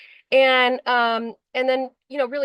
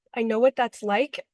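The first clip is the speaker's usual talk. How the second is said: ordinary talk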